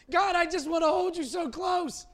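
high, loud mock plea to God; clingy believer. Believer clinging to God instead of trusting, high loud mock plea. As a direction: cry out like a frightened clinging child. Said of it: "How many times do we do that to God?".

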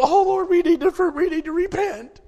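high, put-on meek pleading voice; mock pious whine. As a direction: lampoon the guilty believer. Mocking timid repentance.

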